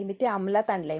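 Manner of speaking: ordinary talk, nothing marked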